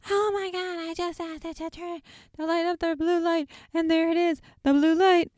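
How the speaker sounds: high-pitched, hammed-up imitation of an excited man; freaking out. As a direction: squeal it like someone freaking out over a result. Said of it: "Billy's freaking out".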